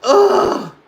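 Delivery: sharp high pained cry; loud pained ugh; pained groan. Real, not acted. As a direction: yelp as the eye stings. Reacts to eye pain.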